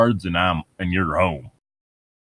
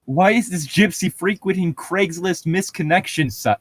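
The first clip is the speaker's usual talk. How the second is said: incredulous; 9 semitones higher than usual. Real, not acted. Incredulous questioning.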